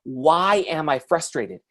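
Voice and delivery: "Why am I frustrated" slowly, word by word; slow down and truly ask "Why am I frustrated"; deliberate self-questioning. Shown as a demonstration. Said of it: "step back and actually ask yourself the question".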